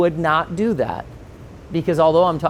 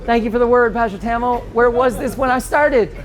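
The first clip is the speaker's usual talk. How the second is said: higher, louder, wry sarcastic thanks; mock thanks; 8 semitones higher, 4 dB louder than usual. Wryly thanking his old pastor for the late advice, higher and louder. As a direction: thank him with a laughing, ironic edge. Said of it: "I was like".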